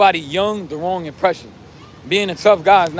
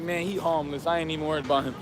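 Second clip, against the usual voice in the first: imitating an unbothered onlooker; 2 semitones lower, 10 dB quieter, faster than usual. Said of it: "I want you to look at me like".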